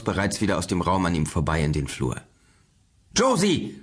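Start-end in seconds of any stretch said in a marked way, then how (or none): 3.2-3.8 put-on high-pitched call for a missing child; urgent searching cry, narrated. A narrator's reading. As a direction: call her name out, pitched way up. Father calls for his missing daughter.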